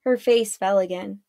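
ordinary delivery

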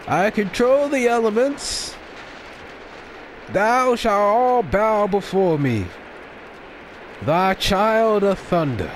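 slow, high grandiose proclamation; megalomaniac rant. Pretend. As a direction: declare yourself a god of thunder.

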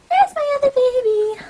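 very high, loud, sustained coo. Real, not acted. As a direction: squeal it way up high, doting.

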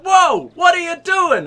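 high, loud, drawn-out outcry; mock alarm. Voicing townspeople who see the drawn weapons, mock alarm. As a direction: imitate a startled crowd, loudly.

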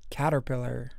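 plain reading voice

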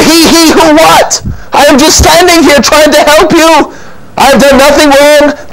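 high, exasperated protest; indignant outburst. Acted. Innkeeper arguing back at Mary, indignant. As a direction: protest your innocence in a high, worked-up voice.

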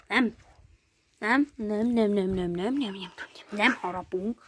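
low, soft run of repeated no's; gentle chiding. Real, not acted. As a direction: chide the dog softly and low. Gently scolds the dog against biting.

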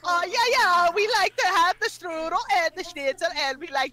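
lightly put-on German accent; a hint of German accent; mock German accent. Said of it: "the stereotype and accent of the German accent".

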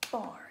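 "Barn" as one firm, single beat; clapped-out syllable. Shown as a demonstration. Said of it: "Let's clap it".

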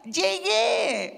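high excited shout; joyful shout of arrival. Acted. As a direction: burst in announcing you've arrived. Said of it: "y le grita".

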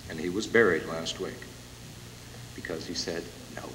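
real emotion catching in the voice; holding back tears on the last words; choked up. Real, not acted. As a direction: grief breaking through the speech. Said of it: "excuse me for getting this emotional".

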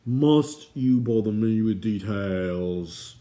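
loud, very slow, put-upon king; long-suffering drawn-out complaint; exasperated cry. Acted. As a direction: groan out each word in royal exasperation. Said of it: "King Edeleg cried".

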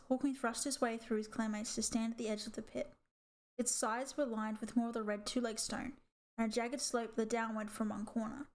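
usual speaking voice

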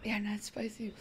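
whispered dry sarcasm; sarcastic whisper. Real, not acted. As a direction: mutter it under your breath, ironic. Sarcastic aside about the candy.

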